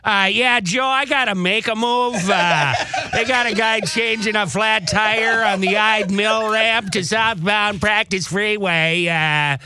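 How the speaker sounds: traffic-tip caller. Acted. Caller tells Joe about a flat tire on the ramp, put-on caller voice. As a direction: play an earnest caller phoning in a road report. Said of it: "Can I play the caller".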